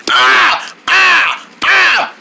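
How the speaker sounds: sharp high scream; short startled screams. Acted. Screams as germs appear.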